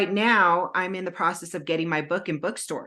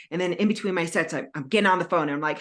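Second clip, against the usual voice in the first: normal talking voice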